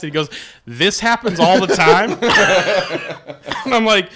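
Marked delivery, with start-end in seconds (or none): 0.7-2.2 high, loud, laughing disbelief; incredulous mock shout. Comic echoes bleeding friend's excuse, incredulous mock shout. 2.2-3.6 high, loud burst of laughter. Real, not acted. Storyteller cracks up at his fainting story, loud laugh.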